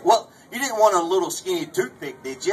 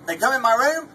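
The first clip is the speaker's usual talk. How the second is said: ordinary delivery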